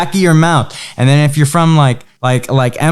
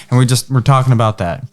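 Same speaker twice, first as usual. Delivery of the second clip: ordinary talk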